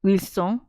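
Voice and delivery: "Wilson" said "weel-SON", French style; Frenchify "Wilson" into "weel-sohn"; "Wilson" in mock French; French pronunciation. Shown as a demonstration. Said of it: "Maybe it's like".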